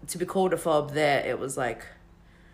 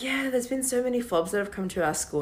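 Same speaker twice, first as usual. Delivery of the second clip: retold chatter; 8 semitones higher than usual. Retells chatting casually to cousins.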